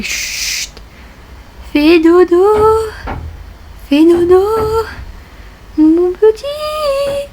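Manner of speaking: slow, high lullaby coo; cooing to sleep. Acted. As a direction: lull the little one to sleep. Putting a Sim to sleep. (berceuse chantonnée)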